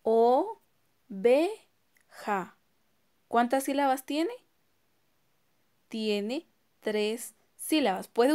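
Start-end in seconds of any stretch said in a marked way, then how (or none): 0.0-2.6 "O, ve, ja." in three separate beats with pauses; teacher splitting oveja into syllables. Shown as a demonstration. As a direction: count each syllable on the fingers. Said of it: "quedaría de la siguiente manera".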